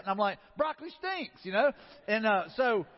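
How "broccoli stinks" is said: high-pitched mock protest; retold protest. Husband arguing back to wife over her cooking, protesting. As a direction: imitate a husband's exasperated comeback, pitched up.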